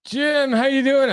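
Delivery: gushing mock-excited greeting; hammed-up greeting. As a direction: greet them in a put-on high voice. Greeting their only listener, hammed up.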